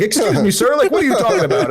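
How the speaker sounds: high-pitched, hammed-up polite protest; mock indignation. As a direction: squeak out a pretend affronted challenge. Imagines politely challenging the tweaker.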